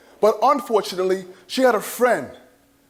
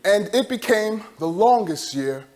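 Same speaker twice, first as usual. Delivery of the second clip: ordinary talk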